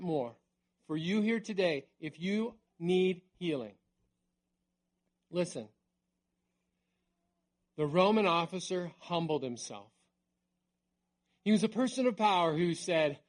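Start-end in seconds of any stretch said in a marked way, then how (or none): none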